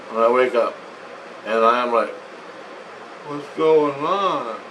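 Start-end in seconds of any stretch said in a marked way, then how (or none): 3.3-4.6 slow, drawn-out acted bewilderment; dazed waking. Patient wakes in machine with pain gone, dazed. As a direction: stretch it out as if just coming to.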